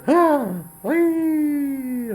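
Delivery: high, startled cry of alarm; a witch's high exclamation of fright; shocked gasp. Acted. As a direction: blurt it out in sudden shock. Witch sees Saul, shocked.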